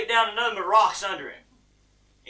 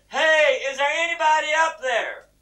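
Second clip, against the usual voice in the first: high, loud call upward; crying out for help; 8 semitones higher, 4 dB louder than usual. Acted. Man on a cliff bush, shouting for help. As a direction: shout to whoever might be above. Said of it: "he cried out for help".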